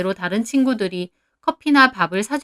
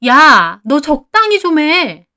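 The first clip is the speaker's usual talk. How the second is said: loud, slowed angry yell; a wife shouts down the phone at a gossiping friend, furious; shouting; 6 semitones higher, 8 dB louder, much slower than usual. Acted. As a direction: snap back at her with a full shout. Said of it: "저는 그 말에 소리를 질렀습니다".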